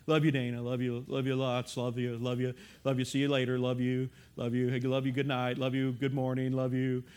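low, monotone rattled-off endearments; low, soft, automatic repetition; rote, meaningless. Shown as a demonstration. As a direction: toss out love-yous on autopilot.

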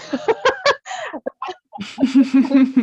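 loud burst of laughter; high bright laugh burst; big laugh. Real, not acted. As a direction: laugh out at herself. Laughing at own plea.

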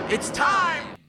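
high, loud dramatic announcement; dramatic announcer shout. Acted. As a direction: boom it like a showman. Announcing the catchphrase.

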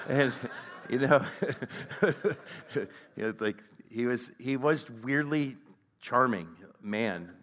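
fond laugh spilling into the words, slowing down. Real, not acted. Laughing while recalling flirty dad.

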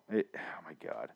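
breathy whispered disbelief. Real, not acted. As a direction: whisper it in dismay. Recalling horrible show.

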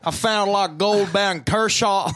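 mock the friend's Southern gold talk; playful Southern accent imitation; exaggerated southern accent. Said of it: "Your southern accent, like, ten X".

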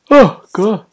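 loud yawn with words. Real, not acted. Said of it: "traditional end of podcast yawn".

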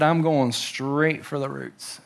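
low, soft, slow and resolute; a hint of a determined vineyard worker; firm worker voice. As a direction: say it like a settled plan. Voicing the vineyard worker firmly.

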